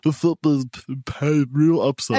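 halting, silly cartoon-character voice; mock a fantasy football asshat, slowing down; asshat fantasy person. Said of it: "asshat fantasy person".

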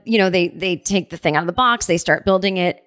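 usual speaking voice

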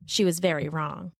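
ordinary delivery, nothing marked

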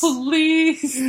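high, playful echo of a cry; mock yell for help. Echoing the woman's call for police, mock yell. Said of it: "this woman's yelling for police".

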